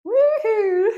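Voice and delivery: high, loud whoop; bright burst of "woohoo"; excited whoop. Real, not acted. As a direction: whoop to cheer the class on. Cheering during the exercise set.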